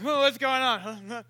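a hint of groggy drawl; slow, lightly sleepy voice; mock waking up. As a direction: play just woken, mildly. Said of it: "So they wake up Jesus".

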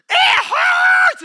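a sudden loud, high cry of pain; pained yell. Retold or imitated. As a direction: belt it out like a woman mid-contraction. Woman in labor crying out.

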